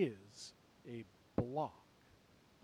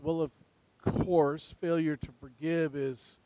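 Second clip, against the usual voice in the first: ordinary delivery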